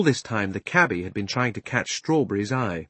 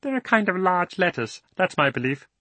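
much higher small animal voice; a little rabbit sure of itself; 9 semitones higher than usual. Acted.

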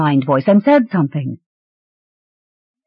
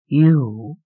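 slow sleepy voice; 4 semitones lower than usual. A narrator's reading. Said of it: "said a slow, sleepy mind voice".

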